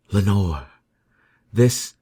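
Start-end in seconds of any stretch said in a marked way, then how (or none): none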